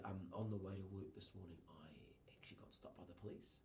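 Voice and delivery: barely voiced, sinking to a whisper; shy quiet confession. Shy young worker telling his boss about the police, whispering. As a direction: confide it in a near whisper, shy and small. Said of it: "Quite a quiet person".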